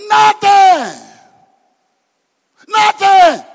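high, loud drawn scream. Real, not acted. Preacher screams the word again at the church.

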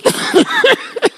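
high-pitched laugh; high laugh at own joke; real laughter. Real, not acted. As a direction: burst out in a high, squealing laugh. Preacher laughs at his own joke.

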